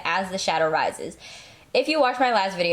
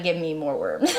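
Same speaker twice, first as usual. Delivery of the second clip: voicing the pet; 5 semitones lower than usual. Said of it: "He's like".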